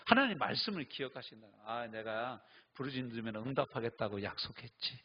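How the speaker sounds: usual speaking voice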